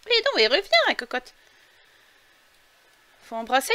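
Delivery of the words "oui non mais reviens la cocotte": high teasing call to a character; coaxing. Real, not acted. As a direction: coax her back playfully.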